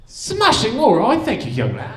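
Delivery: high mock-British cry, dropping at the end; hammed-up swinging British gent; Austin Powers. Stranger thanking the comic for directions, mock British accent. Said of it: "was that Austin Powers?".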